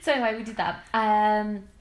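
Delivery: usual speaking voice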